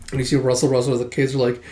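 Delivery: ordinary delivery, nothing marked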